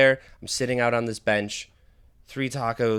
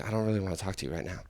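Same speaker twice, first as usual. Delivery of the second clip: soft, low, very quick aside; muttered inner thought; 6 semitones lower, 11 dB quieter, much faster than usual. Retold or imitated. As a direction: rattle it off under your breath. Private thought toward chatty stranger.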